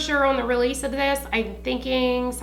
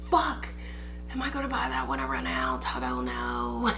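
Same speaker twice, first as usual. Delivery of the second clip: whispering; 5 dB quieter than usual. Retold or imitated. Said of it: "I'm like".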